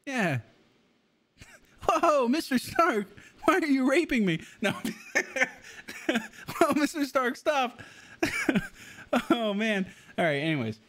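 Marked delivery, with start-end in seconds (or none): 1.9-4.4 put-on high, giggly nervous teen voice, much higher than his own; mocking Tom Holland's Spider-Man. Streamer mocking Spider-Man gushing to Tony Stark, high giggly voice. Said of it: "that was an impression of him". 6.1-7.8 put-on high, giggly nervous teen voice, much higher than his own; mocking Tom Holland's Spider-Man. Streamer mocking Spider-Man gushing to Tony Stark, high giggly voice. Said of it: "That's how he talks".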